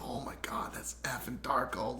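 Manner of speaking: hushed, breathless whisper of awe; awed whisper. Retold or imitated. Buddies spotting the star goalie, whispering in awe. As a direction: whisper it like you can't believe who's there.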